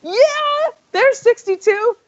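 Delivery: high-pitched mock play-by-play excitement; hammed-up high, thrilled cheer; excited commentary. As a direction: build suspense, then celebrate in a high voice. Retells nearing a record score.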